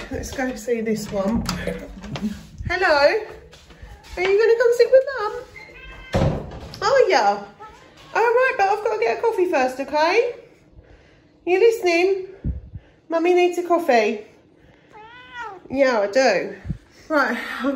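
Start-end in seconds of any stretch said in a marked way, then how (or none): 2.7-14.3 high, fast sing-song pet voice. Real, not acted. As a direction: chirp brightly at the dog.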